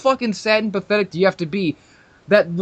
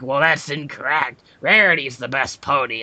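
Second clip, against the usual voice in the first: lower, prim know-it-all correction; mock know-it-all fan; 5 semitones lower than usual. The caller correcting him, lower smug mock fan voice. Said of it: "And he's like".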